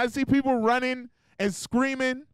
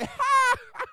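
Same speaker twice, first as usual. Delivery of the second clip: high mock laughter of a whooped kid. Preacher imitating a child laughing while spanked, much higher. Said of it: "they laugh?".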